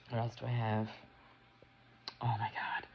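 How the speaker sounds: hushed, breathless oh my God; whispered awe. Real, not acted. Reviewer remembering a scene, whispering in awe. Awed aside to herself.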